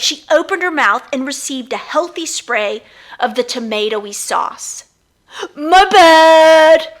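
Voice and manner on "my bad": high, loud careless apology; called out. Acted. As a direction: toss off the sorry loudly. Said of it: "Lucius called".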